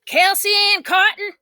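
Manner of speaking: high loud call; yelling up. A narrator's reading. Girl yelling up the apple tree at her sister. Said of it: "I yelled up the ... apple tree".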